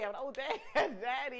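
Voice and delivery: fail to get it out straight; laughing. Real, not acted. Guest trying out the word daddy, laughing.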